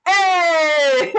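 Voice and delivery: loud "hey" breaking into laughter; triumphant cheer. Real, not acted. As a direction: shout in delight, then laugh. Celebrates the working connection.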